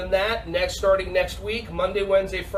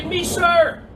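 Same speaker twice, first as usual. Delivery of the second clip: model reply; 9 semitones higher, 6 dB louder than usual. Shown as a demonstration.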